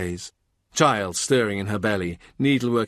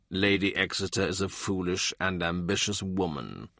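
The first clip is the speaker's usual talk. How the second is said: put-on low, soft, stern old father's voice; stern old man; 4 semitones lower, 6 dB quieter than usual. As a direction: send her off with quiet authority. More dismisses Lady Exeter.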